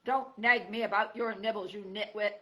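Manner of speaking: loud angry porcupine; telling the fox off hard; bellow. Acted. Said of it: "Aerith bellowed".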